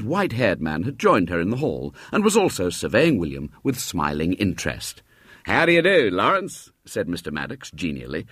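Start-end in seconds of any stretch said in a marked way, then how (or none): 5.4-6.7 higher, friendly greeting; genial old man. Acted. Mr Maddox greeting the boy, genial and higher. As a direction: welcome the boy warmly.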